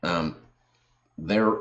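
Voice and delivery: ordinary talk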